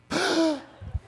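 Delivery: sharp high intake of breath at the end of the read; a big audible breath after the intro; copied on-air gasp; loud gasp. Retold or imitated. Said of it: "you hear the breath".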